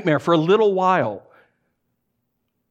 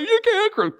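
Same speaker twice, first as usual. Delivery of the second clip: very high-pitched, panicked plea; mock near-tears panic. Flight attendant to a crying colleague, mock near-tears panic. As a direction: imitate someone choking back tears. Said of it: "try not to cry himself".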